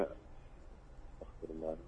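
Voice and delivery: barely audible, slow murmur of repentance; murmured prayer. Real, not acted. Caller murmurs forgiveness to herself, very soft and slow. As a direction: breathe it out slowly under your breath.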